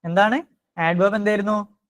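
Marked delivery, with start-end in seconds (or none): none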